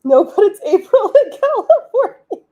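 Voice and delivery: high, loud laughing protest; cracking up while objecting. Real, not acted. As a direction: talk through real laughter. Amused at the chat's remark.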